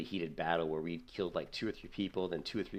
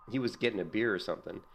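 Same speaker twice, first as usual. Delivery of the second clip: normal talking voice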